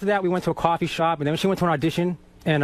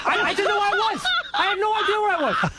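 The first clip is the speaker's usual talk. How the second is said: excited laughing; 12 semitones higher than usual. Real, not acted. Laughing retelling of getting lost.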